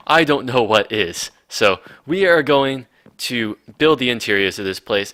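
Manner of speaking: ordinary talk, nothing marked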